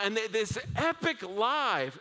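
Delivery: normal talking voice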